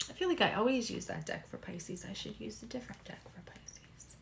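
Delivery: very soft, half-whispered thinking aloud; hushed self-talk. Real, not acted. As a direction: murmur to yourself, almost fading into a whisper. Muttering to self while choosing deck.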